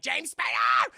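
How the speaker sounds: loud, high holler of a name; hammed-up angry shout; mock enraged yell. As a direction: yell for him like a furious wife. Blonde woman yells for the boss.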